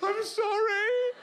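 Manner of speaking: high, slow, timid squeak; meek apology. Retold or imitated. High timid mock apology. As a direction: client apologising to the posh masseur.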